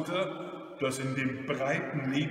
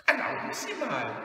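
put-on high, indignant old lady; indignant grandmother's outcry; 8 semitones higher than usual. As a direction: protest in offended astonishment. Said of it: "rief sie entrüstet".

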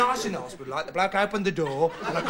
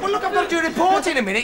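high, fast flustered objection; harried protest; 9 semitones higher, 4 dB louder, faster than usual. Acted. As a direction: protest in a rush, pitched up. Acted argument over delivering clock.

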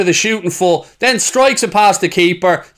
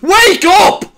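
shout; 11 semitones higher, 5 dB louder than usual. Real, not acted. Yelling at the defence.

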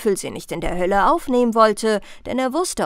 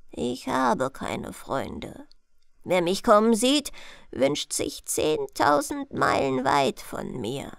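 put-on mysterious old one; slower than usual. Disguised Death telling the princess he is friendless, mysterious.

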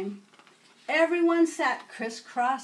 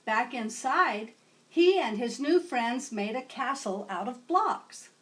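ordinary delivery

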